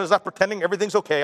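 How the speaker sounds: ordinary talk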